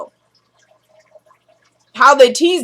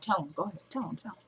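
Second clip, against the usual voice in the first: much softer, urging from the background; hushed background urging; 3 semitones lower, 17 dB quieter, much faster than usual. Retold or imitated. Benny urging from the background during the call, hushed. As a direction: egg him on under your breath. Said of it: "we got Benny in the background".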